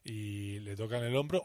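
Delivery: ordinary delivery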